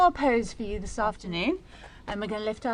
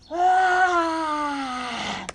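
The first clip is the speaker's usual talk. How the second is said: loud, higher roar, long and falling; dinosaur roar; 5 semitones higher, 7 dB louder than usual. Shown as a demonstration. Teacher opening up again in dinosaur pose, long roar. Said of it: "we're gonna roar".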